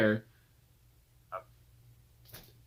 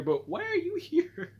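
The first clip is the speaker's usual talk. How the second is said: much higher and slow, stunned question; squeaky disbelief. Retold or imitated. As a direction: stretch it out in high-pitched disbelief. Repeats shocked question.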